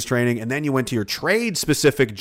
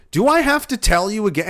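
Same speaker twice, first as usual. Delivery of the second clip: imitating a stern sergeant, louder and higher; serious sergeant; 7 semitones higher, 4 dB louder than usual. A sergeant warning the recruits, in a serious tone. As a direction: bark the question like an annoyed sergeant. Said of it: "he said it in such a serious tone".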